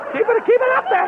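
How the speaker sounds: host playfully jumping into very high pitch. Pretend. Host coaches disguised Tommy to stay high, high voice. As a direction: coach it up in falsetto.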